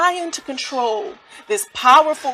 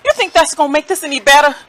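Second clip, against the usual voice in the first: higher, louder, fast and accusing; bitter outburst; 5 semitones higher, 6 dB louder, faster than usual. Acted. Grieving mother turns on the visitor, angry and fast. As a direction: throw it back in her face.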